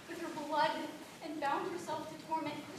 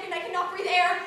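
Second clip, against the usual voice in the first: higher, much louder frantic outburst; panicked cry; 4 semitones higher, 12 dB louder than usual. Acted. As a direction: gasp it out in terror. Girl recounts choking in court.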